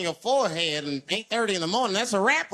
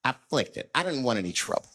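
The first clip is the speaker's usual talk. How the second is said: normal talking voice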